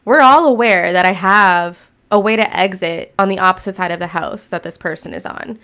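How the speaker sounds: ordinary delivery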